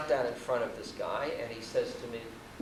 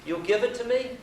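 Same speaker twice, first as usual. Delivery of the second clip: high, louder rising question as the guru; old holy man; 10 semitones higher, 5 dB louder than usual. Retold or imitated. Holy man asking the visitor for the car, high imitated questioning. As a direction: pitch it up high into an expectant question. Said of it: "looks at me and says".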